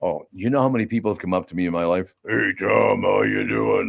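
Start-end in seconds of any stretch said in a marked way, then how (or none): 2.3-3.9 lightly put-on impression of himself; a hint of mimicked greeting; imitators of his voice. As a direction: mimic fans doing your voice. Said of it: "do the same impression of my voice".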